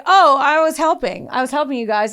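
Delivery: high, loud, sugary fake innocence; mock innocent. Ash-covered suspect to returning cops, hammed-up innocence. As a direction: play it sweetly innocent, hamming it up.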